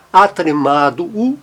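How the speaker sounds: ordinary talk, nothing marked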